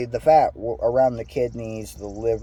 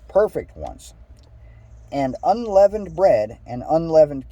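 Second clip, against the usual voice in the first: higher, measured read-aloud voice; scripture reading; 5 semitones higher than usual. Acted. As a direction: read the verse out with lilting emphasis. Reads scripture aloud to listeners.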